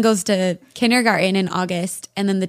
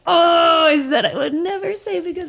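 laughing realization; 7 semitones higher than usual. Retold or imitated. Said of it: "And then I'm like".

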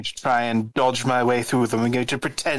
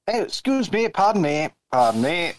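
lightly voiced, higher polite apologies; polite pushing through; 5 semitones higher than usual. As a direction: squeeze through a crowd, a bit pitched up. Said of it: "I'll probably be".

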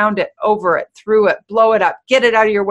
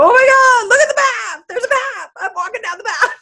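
much higher, louder, hammed-up frantic whine; mock stressed-out panic; 3 dB louder than usual. Person stressed by an ordinary walk, in mock hysterical panic. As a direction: play a stressed-out walker losing it over a simple path. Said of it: "this hyper level of unnecessary drama".

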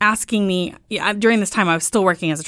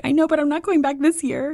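higher, whiny mock pout of herself; pleading whine; 10 semitones higher than usual. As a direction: sulk to the husband in a higher voice. Wife pleading to husband.

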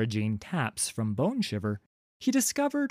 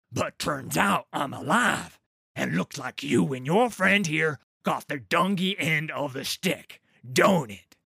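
cocky troll king; 4 semitones higher than usual. Acted. Trundle mocking the choking troll, cocky. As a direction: gloat over the beaten ambushers.

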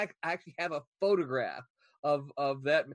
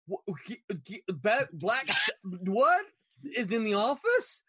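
broken syllables bursting into a high, loud question; stunned stammering; 6 semitones higher, 6 dB louder than usual. Retold or imitated. Starstruck employee told his idol is here, stammering in disbelief. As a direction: sputter in shock, unable to finish a word. Acts out being stunned by news.

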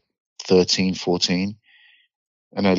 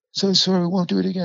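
put-on much higher, pleading voice of a scared kid; whimpering plea; 12 semitones higher, faster than usual. Kid pinned down pleading he won't come back, high and scared. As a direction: beg high and fast, near tears.

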